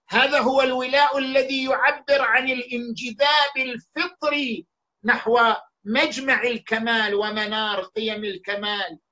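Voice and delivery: usual speaking voice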